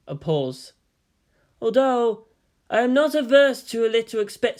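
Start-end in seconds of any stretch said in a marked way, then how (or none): 1.6-4.6 put-on high, louder, coy girlish voice.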